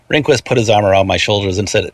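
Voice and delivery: ordinary delivery, nothing marked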